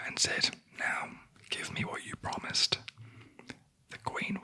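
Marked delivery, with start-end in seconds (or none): none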